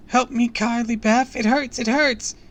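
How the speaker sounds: put-on high, loud child's wail; panicked, sustained high cry; pained wail. A narrator's reading. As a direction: wail for help in pain. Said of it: "Harmony wailed".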